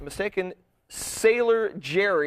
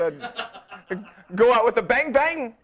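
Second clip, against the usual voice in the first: laughing; 6 semitones higher, 4 dB louder, slower than usual. Real, not acted. Laughing sign-off.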